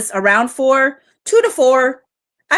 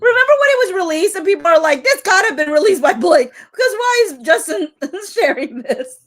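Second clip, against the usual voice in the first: laughing; 7 semitones higher than usual. Real, not acted. Laughing retelling of people.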